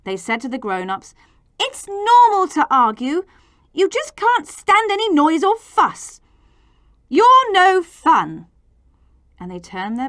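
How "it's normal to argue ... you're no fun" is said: put-on high, loud childish complaint; angry. A narrator's reading. Children complaining to the grown-ups, angry high voice. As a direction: protest crossly, slowing on the last words. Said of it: "now the children were angry".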